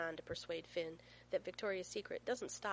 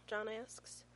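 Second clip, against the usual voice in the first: ordinary delivery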